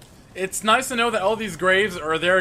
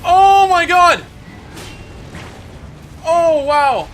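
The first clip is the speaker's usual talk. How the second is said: drawn-out panicked yell; loud shocked shout; 8 semitones higher, 8 dB louder, much slower than usual. Real, not acted. Near death in fight.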